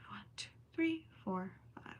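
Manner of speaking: breathy whisper ticking off stitches; whispered counting. Real, not acted. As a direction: count under your breath. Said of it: "I'm counting".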